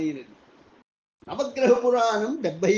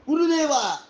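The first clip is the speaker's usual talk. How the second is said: higher, louder pleading call; 7 semitones higher, 4 dB louder than usual. A narrator's reading. Budha begging his silent guru, pleading call. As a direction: beg for his attention. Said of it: "ప్రాధేయపూర్వకంగా అన్నాడు".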